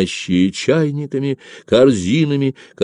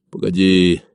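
usual speaking voice

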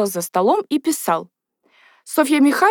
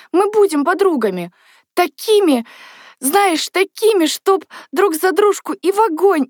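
high, mounting fervour; eager pledge; 8 semitones higher, 3 dB louder than usual. A narrator's reading. Natasha promises Katya they will be friends, fervently. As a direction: swear friendship with rising insistence.

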